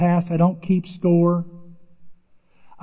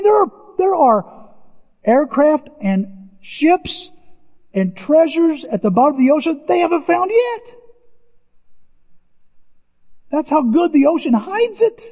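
awestruck; 10 semitones higher, 4 dB louder than usual. Real, not acted. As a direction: marvel at what the sea hides. Marvels at ocean depths.

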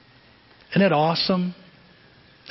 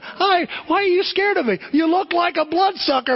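much higher, put-on friendly Dracula greeting; Bob's silly voice; 13 semitones higher than usual. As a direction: play the cute scary guy asking why kids are scared. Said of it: "he's sitting there going".

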